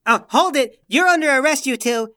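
high, loud order to halt; commanding shout. Acted. Hero stops two fleeing suspects, shouting. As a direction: call out an arrest forcefully.

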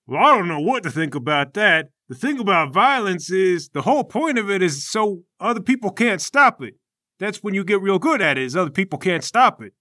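high, loud character voice; earnest fighter explaining his philosophy loudly; Obliterator, easygoing fighter. Acted.

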